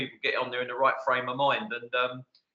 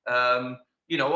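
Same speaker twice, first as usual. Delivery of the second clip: usual speaking voice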